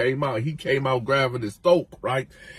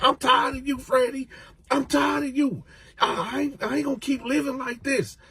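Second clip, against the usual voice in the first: high whiny complaint; 11 semitones higher than usual. Retold or imitated. Coco arguing, laughing shout.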